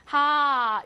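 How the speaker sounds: 하 held long in one stretched vowel. Shown as a demonstration. Teacher shows students the long vowel, 하 drawn out. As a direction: draw out 하 as one long sound. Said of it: "길게 한 번만".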